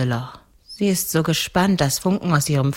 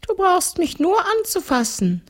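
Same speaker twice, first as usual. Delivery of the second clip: very high-pitched ghost voice. Acted. Ghost instructs the girl. (hohe Gespensterstimme)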